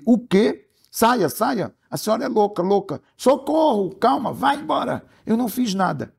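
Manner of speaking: a high, frantic man shooing her away; panicked man. Acted. Author voicing the frightened man fleeing the woman, panicky and high. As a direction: voice the man high and scared, begging to be left alone. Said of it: "Aí ele".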